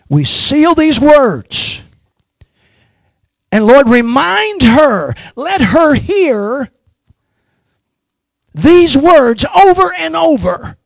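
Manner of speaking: high, very loud, slow-held cries; shouted prayer. Real, not acted. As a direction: cry out the prayer at full force, stretching words.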